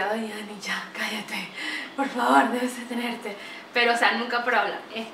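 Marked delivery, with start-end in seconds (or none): 0.0-3.4 a hushed, half-whispered plea to stop talking; mock plea to stop. Friends begging Ani to stop talking, hushed mock plea. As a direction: sigh it like a tired friend. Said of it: "la gente es como que".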